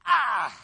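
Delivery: loud "ah" of dismay; a sudden loud groan of dismay; groan of regret. Shown as a demonstration. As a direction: groan as if you twisted it too far. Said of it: "did something and went".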